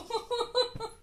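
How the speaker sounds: high-pitched string of ha-ha; high laugh. Real, not acted. Playing with the toddler. (ऊँची आवाज़ में हँसी)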